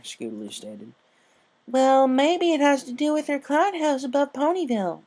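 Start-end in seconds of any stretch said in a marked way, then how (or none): 1.7-5.0 much higher little filly voice; a child filly offering an idea; young filly. A narrator's reading. Apple Bloom suggests a reason, high filly voice. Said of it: "Apple Bloom offered".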